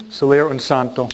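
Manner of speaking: normal talking voice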